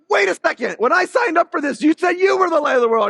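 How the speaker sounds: high, loud mock protest; indignant surprise. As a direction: act out the startled objection. Believer objecting to Jesus.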